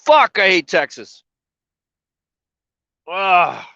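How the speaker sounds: a loud, high, slow tearful curse; tearful outburst. Real, not acted. Stuck in Texas and crying, he curses loudly. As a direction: blurt it out, frustrated and crying.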